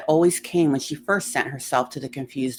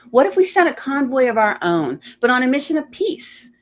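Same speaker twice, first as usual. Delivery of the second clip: higher, louder hopeful pitch; bright eager proposal, raised; eager idea; 8 semitones higher, 5 dB louder than usual. Acted. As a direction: pitch the idea with sudden hope.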